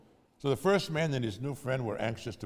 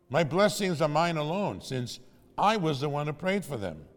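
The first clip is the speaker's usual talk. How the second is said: higher, louder indignant reply to heaven; raised, insistent protest; defiant self-justification; 6 semitones higher, 5 dB louder than usual. A narrator's reading. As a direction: argue your case up to the sky. Man argues back to heaven.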